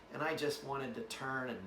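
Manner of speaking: normal talking voice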